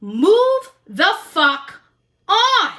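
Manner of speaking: ordinary delivery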